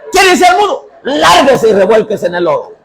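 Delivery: grito agudo y fuerte, echándola; grito furioso. Retold or imitated. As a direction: gritale que se largue. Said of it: "le dije". (el pastor le grita a la hermana que se vaya)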